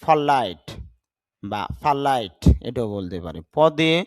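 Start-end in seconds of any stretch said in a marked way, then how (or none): none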